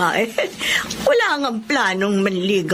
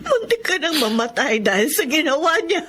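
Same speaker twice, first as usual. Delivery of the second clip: higher voice breaking into a sob; choked crying; 8 semitones higher than usual. Acted. Older sister grieving over her beaten sibling, tearful. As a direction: cry through the words.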